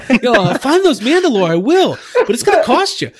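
put-on high laughing Boston accent; hammed-up Boston squid voice, laughing. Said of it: "a thick Boston accent".

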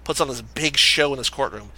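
usual speaking voice

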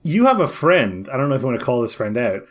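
ordinary talk, nothing marked

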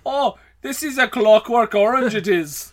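slow, teasing Irish brogue; mock the Irish voice, slowly; Irish accent. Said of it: "making him Irish".

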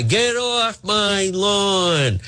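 high, slow mock cranky old man; hammed-up old man yelling at kids; angry old man. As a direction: play a grumpy old geezer. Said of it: "like an angry eighty-year-old guy".

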